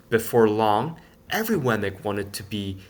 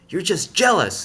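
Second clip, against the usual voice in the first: taunting retort; 8 semitones higher, 6 dB louder than usual. A narrator's reading. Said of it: "they replied".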